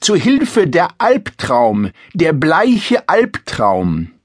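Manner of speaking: theatrical princess screeching at a pale suitor; mock screech. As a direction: shriek in play-acted terror behind the throne.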